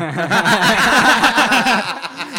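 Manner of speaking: big high laugh breaking out; loud burst of laughter. Real, not acted. Laughing hard at the cousin's pageant jab. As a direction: crack up loudly at the taunt.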